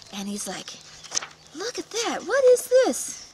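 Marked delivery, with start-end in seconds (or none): 1.5-3.2 very high, loud cartoon spider; hammed-up discovery. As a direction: overact the surprise to trap her. Spider pretends to discover rock.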